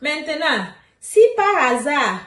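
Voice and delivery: ordinary talk, nothing marked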